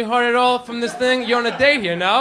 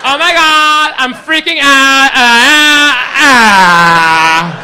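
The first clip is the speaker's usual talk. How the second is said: loud, high imitation of a girl freaking out; mock freak-out; 4 semitones higher, 7 dB louder, much slower than usual. Girl amazed he guessed her sign, imitated in a hysterical freak-out. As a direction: squeal into drawn-out wails, hammed up. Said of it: "you think she's freaking out".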